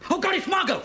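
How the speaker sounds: high, loud panicked yell; hysterical panic. Acted. Husband recognising the neighbour on the phone, hysterical panic. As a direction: cry out in alarm at the caller. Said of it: "one to respond to Latinesque hysteria".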